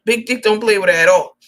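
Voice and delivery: emphatic loud repeat. Shown as a demonstration. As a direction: lean hard on "play" the second time. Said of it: "repeats it louder".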